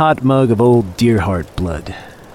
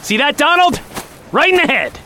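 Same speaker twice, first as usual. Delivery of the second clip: triumphant shout; 11 semitones higher than usual. Acted. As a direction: crow over the kill. Hunter shoots the deer.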